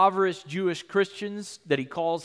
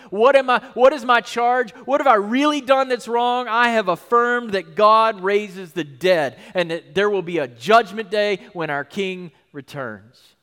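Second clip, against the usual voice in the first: louder, pressing questions; Paul defiant; 2 semitones higher, 6 dB louder than usual. Retold or imitated. Paul challenging the accusers over his charge, defiant. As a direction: stand your ground. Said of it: "he says in verse twenty".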